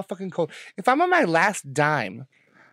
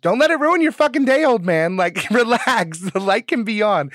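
higher, louder yell at dad; retold yell; 4 semitones higher, 5 dB louder than usual. Call it out loudly, telling him to relax. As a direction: daughter yelling to dad through the office door, exasperated yell. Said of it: "I, like, yell out the window".

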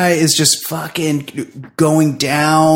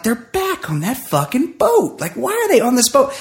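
high, incredulous groan; mock outrage at the boat; rolling eyes at the same boat again; exasperated quote; 11 semitones higher than usual. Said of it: "I'm like".

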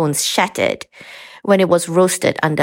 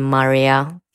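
usual speaking voice

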